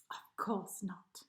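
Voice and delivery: slow, hushed whisper. A narrator's reading. Narrator whispering the answer to listeners.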